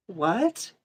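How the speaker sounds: mock stunned confusion; retold disbelief. Surprised she is the one being met, mock baffled. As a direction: play it baffled, half-laughing. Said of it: "And I was like".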